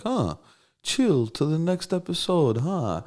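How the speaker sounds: high, slow mock-mom voice; drawn-out, amused imitation of his Russian mom puzzling over the lyric; imitating mom. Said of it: "she'd be like".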